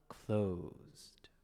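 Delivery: breathy stage whisper, dropped low. Pretend. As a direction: whisper it like a secret verdict. Dramatically closing the request queue.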